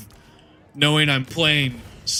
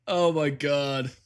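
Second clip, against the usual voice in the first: slow dismay; much slower than usual. Real, not acted. After game crash.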